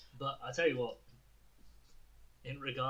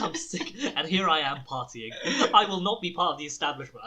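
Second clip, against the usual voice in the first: mock defiant proclamation; 3 semitones higher, 10 dB louder than usual. Mock rebel speech.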